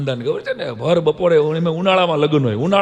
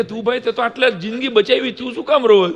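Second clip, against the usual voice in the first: crowd asking; 8 semitones higher than usual. Retold or imitated. Crowd asking him why.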